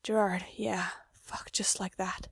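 breathless whisper to his brother; barely voiced, slow and hushed. A narrator's reading. Mikey whispering to Gerard in bed, hushed whisper.

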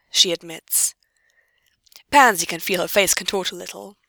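ordinary delivery, nothing marked

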